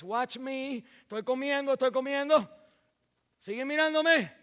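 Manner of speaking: calls the disciples to watch him eat, loudly; Jesus commanding. Acted. Jesus tells disciples to watch him, loud and insistent.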